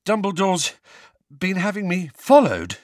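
high, effortful question; halting, broken by a pause for breath; panted. A narrator's reading. Boy hauling his cousin questions neighbour, panting. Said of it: "Harry panted".

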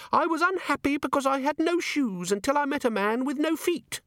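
very high, chirpy little device voice; tiny imp chiming. A narrator's reading. The pocket organiser imp announcing itself to Vimes, chirpy. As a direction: sing out the chime like a small gadget.

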